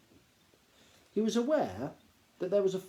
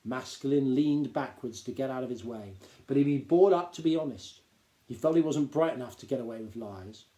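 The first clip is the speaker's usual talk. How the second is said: ordinary delivery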